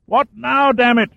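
high, loud irritated call up a shaft; irritated shout from below. Acted. Caldweiler shouting up to Shaw, irritated and loud. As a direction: bellow up from the bottom of the pit, annoyed.

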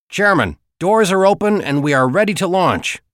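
high, loud report over radio. Acted. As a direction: call in over the noise. Radio report from boat team.

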